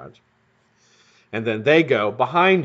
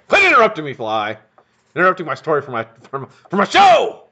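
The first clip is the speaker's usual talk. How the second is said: raised, higher mock scolding at a fly; 4 semitones higher, 5 dB louder, faster than usual. Real, not acted. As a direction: scold the buzzing fly, half joking. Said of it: "scolding the fly on stream".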